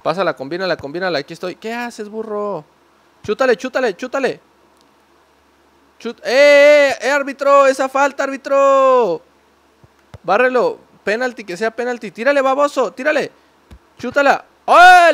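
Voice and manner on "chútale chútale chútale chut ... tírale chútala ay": high, loud urgent yells; shouting. Real, not acted. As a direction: yell at the referee and players. Yelling at players and referee. (gritos de partido)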